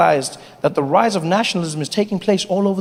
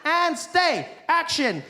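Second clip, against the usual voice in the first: calling action; 6 semitones higher, 7 dB quieter, much slower than usual. Retold or imitated. Director yelling action to start the shoot. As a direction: shout the cue across the set.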